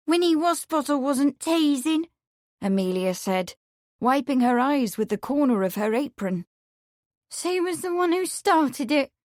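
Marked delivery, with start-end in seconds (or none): 0.1-2.1 put-on high, wounded young woman's protest; tearful. A narrator's reading. Amelia complains to Lawrence who comforts her, tearful. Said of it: "still sobbing". 7.3-9.1 high, hurt accusing voice; tearful. A narrator's reading. Amelia blames Winnie while Lawrence comforts her, tearful. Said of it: "still sobbing".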